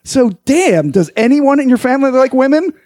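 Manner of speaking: incredulous bartender asks the man. Acted. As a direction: ask it in mock disbelief. Bartender exasperated question.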